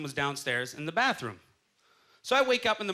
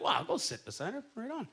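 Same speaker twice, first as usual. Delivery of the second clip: quiet aside; 4 semitones higher, 6 dB quieter than usual. Retold or imitated. Quiet thought about empty seat.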